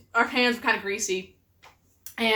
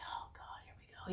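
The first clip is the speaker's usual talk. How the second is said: hushed, under the breath; a resigned whisper of dread; exasperated whisper; 26 dB quieter than usual. Retold or imitated. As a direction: whisper it like you're bracing for bad news. Wife dreading husband's confession.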